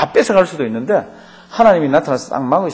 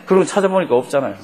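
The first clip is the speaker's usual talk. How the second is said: ordinary talk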